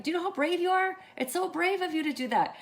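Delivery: high, loud re-enacted praise; proud gushing. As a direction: gush with pride to your niece. Retold gushing praise of niece.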